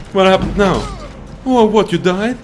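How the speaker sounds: high, loud disbelief; loud surprise. Real, not acted. Player seeing companion drop dead, loud surprised exclaim. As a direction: caught off guard, exclaiming.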